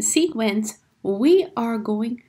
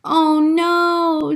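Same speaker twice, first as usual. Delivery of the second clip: drawn-out, raised cry of alarm; mild storybook scream; 3 semitones higher, 5 dB louder than usual. A narrator's reading. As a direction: read a scream without going all out.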